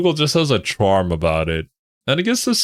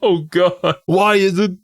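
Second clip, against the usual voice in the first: laughing; 11 semitones higher than usual. Real, not acted. Laughing while speaking.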